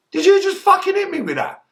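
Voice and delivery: high-pitched disbelief after the axe blow; shocked high cry. Retold or imitated. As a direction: sound stunned, voice leaping high.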